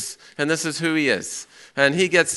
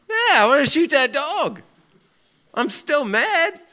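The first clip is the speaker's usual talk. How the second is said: much higher, louder seething thoughts, slowing down; inner anger; 12 semitones higher, 5 dB louder than usual. Retold or imitated. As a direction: let the hidden rage leak out. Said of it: "what's he feeling inside?".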